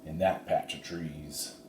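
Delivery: Slim keeps it down near the rustlers; hushed, careful words; low voice. A narrator's reading. Slim pointing out the camp, low voice. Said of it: "Slim said in a low voice".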